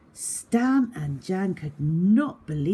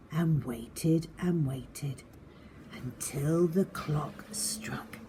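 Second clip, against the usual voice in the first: hushed storytelling. A narrator's reading. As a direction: draw the children in with a secret hush.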